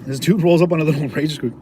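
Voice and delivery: ordinary delivery, nothing marked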